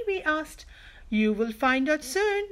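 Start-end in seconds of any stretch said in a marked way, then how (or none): none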